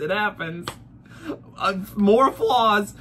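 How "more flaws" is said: ordinary delivery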